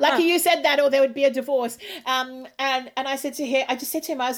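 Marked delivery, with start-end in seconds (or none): 0.0-1.8 raised, loud mock warning; joking retort. Wife teasing husband on video chat, mock warning. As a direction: playfully threaten him, pitched up.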